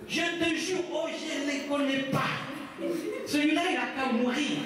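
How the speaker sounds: high-pitched oath in Ivorian accent; accent ivoirien. Shown as a demonstration. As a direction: swear it as Pierre, hammed-up Ivorian accent. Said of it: "Pierre se mit à faire des imprécations". (imprécations jouées, accent ivoirien aigu)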